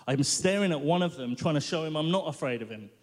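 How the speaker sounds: usual speaking voice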